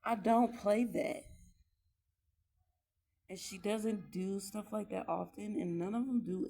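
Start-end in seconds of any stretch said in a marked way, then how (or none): 0.0-1.2 loud, slow and firm, laying down the law; a mother drawing the line, no arguing; slow, loud flat refusal to put up with it; stern firm no. Real, not acted. Mom stating her strict rule.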